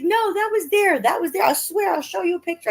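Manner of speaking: high, emphatic protest; high insistent neighbor. Retold or imitated. Mom imitating the neighbor, high and insistent. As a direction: swear it was already there.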